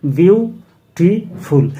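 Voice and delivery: Beautiful split into three slow beats, bew-ti-ful, with clear gaps; syllable by syllable. Shown as a demonstration. Teacher counts three syllables for students, beautiful slowly broken apart. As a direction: sound out beautiful slowly, pausing after each syllable. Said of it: "এখানে তিনটে syllable আছে".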